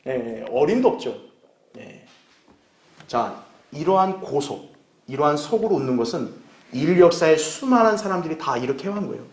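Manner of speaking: usual speaking voice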